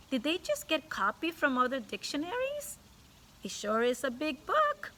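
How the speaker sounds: sly innocent question. Acted. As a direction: lay the innocence on thicker. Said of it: "let me reread that again".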